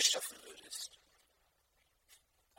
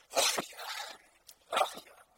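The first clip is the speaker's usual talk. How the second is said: sighing ach ja, heavy and louder; 5 dB louder, slower than usual. Shown as a demonstration. Pastor demonstrating the everyday sigh, weary and louder. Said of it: "so richtig schön seufzend".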